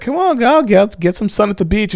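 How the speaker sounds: mom voice. Retold or imitated. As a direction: coax the kid out to the sun. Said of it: "My mom's like".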